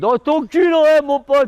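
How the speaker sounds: rowdy fan taunt; mocking jeer. Real, not acted. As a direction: jeer back at the Marseille fans. Mocking rival fans, raised voice.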